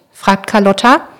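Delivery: ordinary delivery, nothing marked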